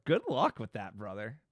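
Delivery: ordinary talk, nothing marked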